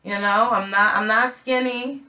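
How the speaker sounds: ordinary talk, nothing marked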